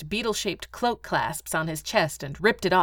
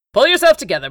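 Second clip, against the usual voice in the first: raised, loud rallying order; brisk command; 7 semitones higher, 10 dB louder, faster than usual. Acted. Baron rallying his dazed secretary, brisk command. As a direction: order him firmly to recover.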